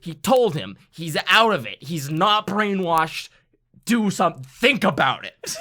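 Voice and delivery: loud and high, worked up; excited. Real, not acted. Cohost rants excitedly about a player. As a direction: get fired up about it.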